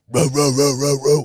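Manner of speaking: barking row row, loud; retold dog barking at an old man; mock dog bark. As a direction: imitate a guard dog barking. Imitating dog's bark.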